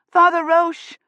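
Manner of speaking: high loud desperate call; desperate cry. A narrator's reading. Woman calling for the priest in terror, desperate cry. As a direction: cry out for help. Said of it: "she cried desperately".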